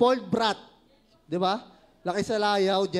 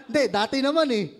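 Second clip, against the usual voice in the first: laughing; 5 semitones higher, 6 dB louder than usual. Real, not acted. Teasing the congregation, laughing.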